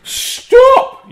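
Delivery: very high, loud shrieked stop; teacher shrieking at the laughing girl, very high; teacher's shriek. Acted. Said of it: "yelled Missus Gomez".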